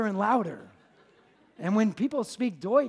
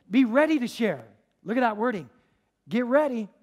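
loud, raised preacher delivery; forceful preaching; 6 semitones higher, 6 dB louder than usual. Acted. Preacher urging the church to be ready to share, forceful preaching. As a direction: press it home, high and loud.

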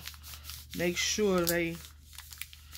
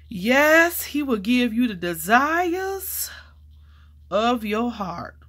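preachy praise; 4 semitones higher, slower than usual. Acted. Preaching scripture about her find.

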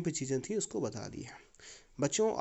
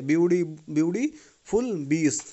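slow German accent, "बीज" with B for P; German accent, ब्यूटीफुल with D and बीज with B; German accent demo; 5 dB louder, much slower than usual. Shown as a demonstration. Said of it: "पी को यहाँ पे बी बोला गया है".

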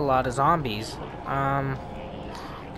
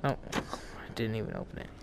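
whispered aside; 8 semitones lower, 16 dB quieter than usual. Real, not acted. Quiet aside while gaming.

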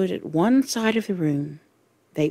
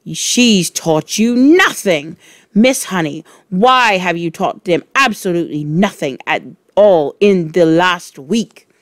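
loud gloating accusation; triumphant; 6 dB louder than usual. Acted. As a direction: gloat at the teacher.

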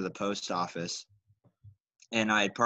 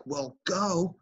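irritated driver; 7 semitones higher than usual. Retold or imitated. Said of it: "he was like".

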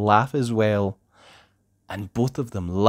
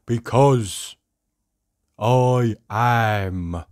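drawn-out, sad cartoon moan; Mister Miserable; much slower than usual. A narrator's reading. Sad character answers the happy visitor, mournful and drawn-out. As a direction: answer glumly, stretching each word. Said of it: "replied Mister Miserable".

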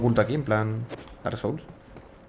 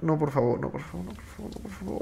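begging please, fading to near silence; pleading; 4 semitones higher, 6 dB quieter than usual. Real, not acted. Gamer begging the game not to kill him, pleading. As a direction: plead softly, voice dying away.

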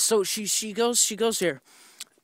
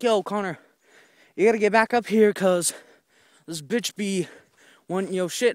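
mom calling; 6 semitones higher, 9 dB louder than usual. Retold or imitated. Mom calling him home.

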